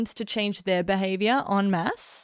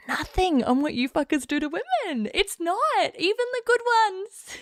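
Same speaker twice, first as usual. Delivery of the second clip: high-pitched rant; 10 semitones higher than usual. Real, not acted.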